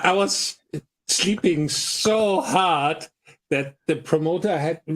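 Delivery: usual speaking voice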